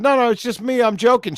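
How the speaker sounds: high-pitched mock pleading; imitated pleading grandson. Listener acting out the grandson's confession, mock urgency. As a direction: plead lightly as the prankster.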